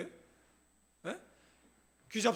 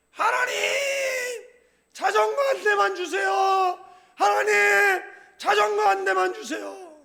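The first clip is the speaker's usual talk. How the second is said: put-on high, loud childish pleading; child shouting prayer; 8 semitones higher, 8 dB louder than usual. Child loudly begging God for a bike. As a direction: yell the prayer like a kid begging. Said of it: "조용히 기도해".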